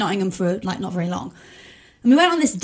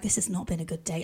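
hushed, quiet; 6 semitones lower, 13 dB quieter than usual. Retold or imitated. Date's silent look, voiced.

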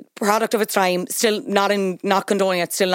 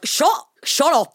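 higher, drawn-out exasperated retort; slow down on a raised, scolding protest; mock outrage; 6 semitones higher, much slower than usual. Co-host answering the judge's comment, mock outraged.

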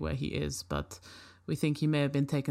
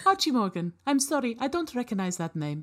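polite robot; 8 semitones higher than usual. Acted. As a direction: answer like a helpful but clueless robot. Robot cannot find name.

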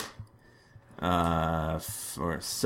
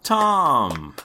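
calling out; 6 semitones higher, 4 dB louder than usual. Real, not acted. GM calls player loudly.

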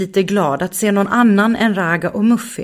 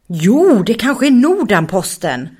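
bright, eager guess, a little louder; eager hope; 4 semitones higher, 4 dB louder than usual. Acted. Pojken ropar hoppfullt till vännerna, ivrigt. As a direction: blurt the hope out quickly.

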